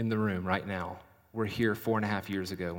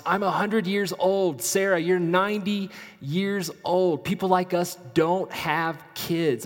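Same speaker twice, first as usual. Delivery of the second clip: put-on loud, high-pitched protest as old Abraham; acted outburst of disbelief; incredulous protest; 8 semitones higher, 5 dB louder than usual. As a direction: plead the impossibility, pitched up and loud. Acts Abraham protesting to Sarah.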